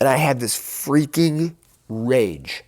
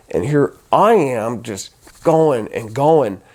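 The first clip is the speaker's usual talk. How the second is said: ordinary delivery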